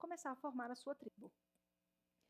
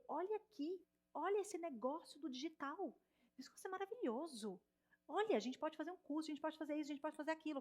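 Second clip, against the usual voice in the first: higher, eager voice retelling a big idea; eager, listing possibilities brightly in a higher pitch; excited pitch; 4 semitones higher than usual. Retold or imitated. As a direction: sell the new idea with enthusiasm. Retells own excited pitch to someone.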